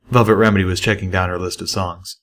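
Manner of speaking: plain reading voice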